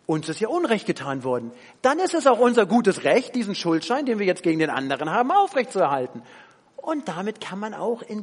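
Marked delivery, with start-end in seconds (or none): 0.0-6.2 loud, self-righteous claim of being wronged; indignant victim. Retold or imitated. The preacher voices a wronged person clinging to a debt, loud and self-righteous. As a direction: insist on your rights like an offended victim.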